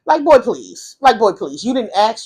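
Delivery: loud, sassy brush-off; scornful retort. Retold or imitated. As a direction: scoff at him in disbelief. Scornful inner retort to boyfriend.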